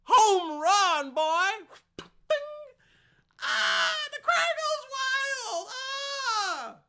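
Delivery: very high, screaming mock crowd roar; play-acted home-run celebration at full scream; hammed-up cheering. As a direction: holler like a crowd gone wild.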